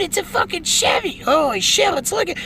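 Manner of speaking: usual speaking voice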